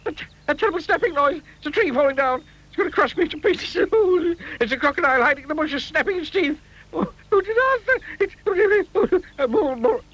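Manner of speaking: high, fast frightened babble; panicking. A narrator's reading. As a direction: spiral into terror over a twig. Mister Jelly panics.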